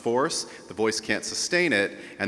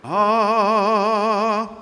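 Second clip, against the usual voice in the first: much higher, louder ah; high note position; 12 semitones higher, 9 dB louder than usual. Shown as a demonstration. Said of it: "the position in your throat for the high note".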